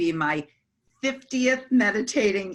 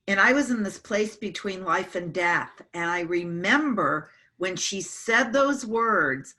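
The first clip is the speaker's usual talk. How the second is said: ordinary talk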